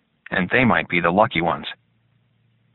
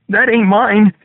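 high, loud panicked protest; desperate shout; 13 semitones higher, 7 dB louder, slower than usual. A narrator's reading. Captive protests to screaming soldier.

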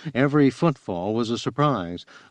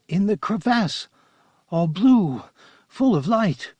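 high, strained voice in short phrases, not truly whispered; whistling whisper; 9 semitones higher than usual. A narrator's reading. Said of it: "said in a whistling whisper".

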